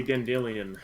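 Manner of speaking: Dandelion said as dan-DIL-ee-un; Dandelion with stressed DIL, ending in "ee-un"; audiobook pronunciation. Shown as a demonstration. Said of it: "All of the books on audio say".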